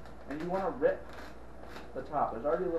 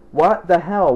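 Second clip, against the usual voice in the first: exasperated; 17 dB louder, slower than usual. Real, not acted. Stove smoking, annoyed.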